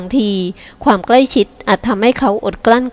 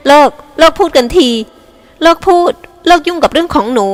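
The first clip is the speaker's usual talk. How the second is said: shouting stop; 6 semitones higher than usual. A narrator's reading. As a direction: yell at her to drop it. Sister shouting to end argument.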